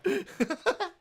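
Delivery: high burst of laughter. Real, not acted. Laughing at the clip.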